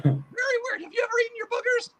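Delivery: a high cackling boogeyman; boogeyman puppet voice. Acted. Puppet boogeyman greets the interviewer in a high creepy voice. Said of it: "Hey, boogeyman, come over here".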